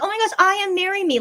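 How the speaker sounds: high, breathless gushing fan voice; gushing fangirl. Retold or imitated. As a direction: gush like a starstruck fan. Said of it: "or, like, saying".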